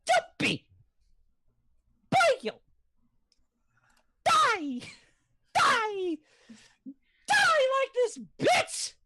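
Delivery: high screaming game-character voice; Hundred Knight. Acted. Impressionist asked for Hundred Knight, high screamed attack lines. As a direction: screech it in short bursts. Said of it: "Hundred Knight".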